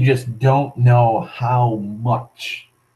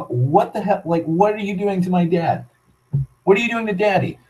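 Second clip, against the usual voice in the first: higher pitched, mildly imitated upset child; child's crying plea; 7 semitones higher than usual. Child begging police about his dad, higher mild crying plea. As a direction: replay the little boy begging, a touch tearful. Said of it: "all I'm doing is crying my eyes out".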